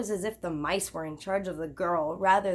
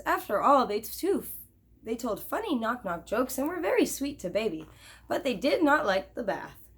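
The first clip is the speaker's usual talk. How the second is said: usual speaking voice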